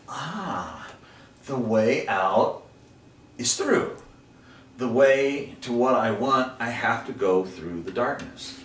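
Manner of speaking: slow, loud, weighty realization; awed discovery, drawn out and emphatic; dawning revelation. Retold or imitated. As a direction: proclaim it slowly, word by word. Acts out his moment of revelation.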